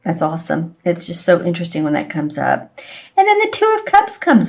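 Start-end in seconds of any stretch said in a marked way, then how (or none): 3.2-4.5 pitched way up, light and sweet; higher voice. Real, not acted. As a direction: lift the voice high on the card name. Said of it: "my voice went higher as I said that".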